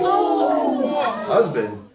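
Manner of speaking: high, drawn-out incredulous cry; shocked disbelief. Retold or imitated. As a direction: echo the word in hammed-up shock. Shocked repeat of her news.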